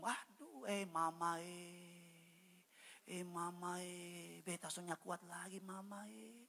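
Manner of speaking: hammed-up keening to mama; mock wailing lament. Sinner exposed in public cannot bear it, mock wailing lament.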